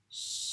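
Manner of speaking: "Ch" as a very long hush, then a held ê; drawn-out "Ch" opening into its vowel; sounding out chê. Shown as a demonstration. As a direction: stretch "Ch" slowly while writing it. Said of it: "dire tous les sons qu'on écrit".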